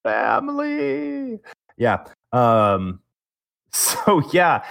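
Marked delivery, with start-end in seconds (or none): 0.0-1.4 mock drawn-out. Real, not acted. Echoing a movie-series joke.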